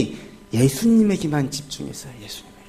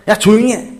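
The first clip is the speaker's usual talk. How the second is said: louder, higher mock rebuke; retold sharp telling-off; scolding hush; 4 semitones higher, 5 dB louder than usual. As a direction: imitate a crowd shushing a beggar. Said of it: "꾸짖으면서 조용히 시켜요".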